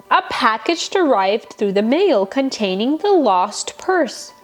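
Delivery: usual speaking voice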